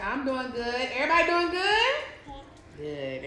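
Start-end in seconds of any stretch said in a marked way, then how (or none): 0.0-1.9 sing-song greeting. Real, not acted. Mom greets the baby, high sing-song. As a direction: greet everyone with lifted cheer.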